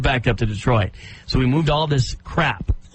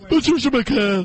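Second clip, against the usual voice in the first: high mock radio voice; name announced in a put-on high voice; Patricia McCann; 9 semitones higher than usual. Host playing her relaxing by saying her name, high parody. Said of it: "By saying my name".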